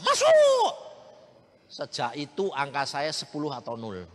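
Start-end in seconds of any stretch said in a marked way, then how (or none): 0.0-0.7 loud, high burst of triumph; excited cry. Retold or imitated. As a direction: shout it out in sudden delight. Excitedly recalls everything stored.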